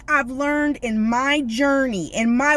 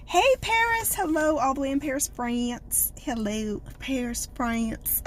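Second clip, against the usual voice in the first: country accent; 7 dB quieter than usual. Shown as a demonstration. Greeting Paris viewers in a country Atlanta accent. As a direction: stretch the hello, Southern country style. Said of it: "Give you a country accent from Atlanta".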